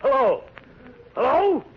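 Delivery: high, loud outraged yells into the phone; outraged shouting. Acted. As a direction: bark it up high and loud, furious with disbelief. Learns the bond was doubled.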